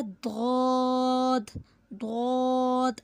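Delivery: दौद slow, heavy, from the tongue's edge; letter demo. Shown as a demonstration. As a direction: drag दौद out with the tongue on the molars. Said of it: "ज़बान का हाफा".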